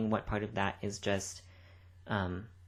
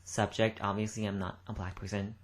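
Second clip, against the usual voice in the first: usual speaking voice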